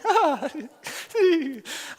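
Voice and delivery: high, loud put-on laugh; hammed-up polite giggling; imitated laughter. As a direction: pretend to laugh along without understanding. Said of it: "everybody's laughing".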